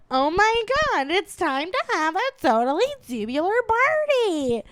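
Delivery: put-on high, gushing Valley Girl accent; squealing Valley Girl inside joke. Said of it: "we'd go".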